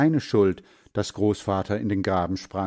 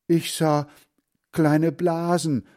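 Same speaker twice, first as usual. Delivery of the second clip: higher, halting, mildly shaken; understated shivering grandfather; barely able to speak; 8 semitones higher, 4 dB louder than usual. A narrator's reading. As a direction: break the phrase with a hesitant pause. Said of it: "er kann kaum sprechen".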